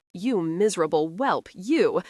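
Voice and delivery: usual speaking voice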